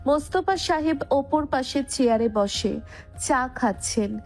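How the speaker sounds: ordinary delivery, nothing marked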